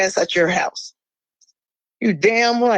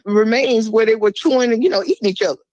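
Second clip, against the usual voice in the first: ordinary delivery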